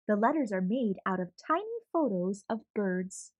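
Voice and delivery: usual speaking voice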